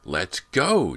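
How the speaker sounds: eager cry. A narrator's reading. James tugs at Callie, eager loud cry. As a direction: urge her along, keen and loud. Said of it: "James cried".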